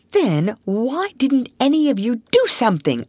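high, exasperated demand; indignant scolding. Acted. Otter takes the rabbit to task, indignant.

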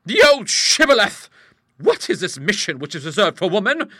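loud, high oratory; impassioned rhetorical outburst; indignant tirade. Acted. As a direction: declaim like a fiery campaigner. Hostess's indignant speech after cry.

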